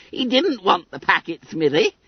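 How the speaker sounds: put-on high, squeaky boy full of giggles; gleeful Billy Bunter. A narrator's reading. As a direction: crow it in a high chirp. Bunter crows between giggles.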